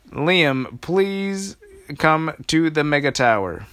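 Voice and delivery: high, loud public announcement; megaphone announcement. Acted. Old lady calling for Liam over the megaphone, loud announcement. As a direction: call it out over the whole park. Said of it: "she had a megaphone".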